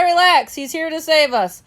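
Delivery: high, loud call to the others; crying out. A narrator's reading. Bob cries out to calm the panicking cage mates. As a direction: shout over the screeching animals. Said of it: "I cry".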